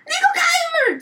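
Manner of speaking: very high mock shriek; 模仿妈妈怒吼. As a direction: scream the order like a furious mom. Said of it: "我妈就骂她姐说". (学妈妈尖声怒喊)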